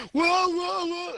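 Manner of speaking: high, loud repeated cartoon cry; mock panicked whoas. Podcaster imitating the guy when it cuts to his face, mock panic. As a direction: yelp each one in hammed-up alarm. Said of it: "he's going".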